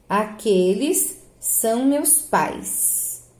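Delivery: very slow, "pais" as one long gliding syllable; demonstrated pronunciation. Shown as a demonstration. Teacher reading the example for pupils, slow and measured. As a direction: spread the words out, keep "pais" whole. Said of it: "Olha a pronúncia".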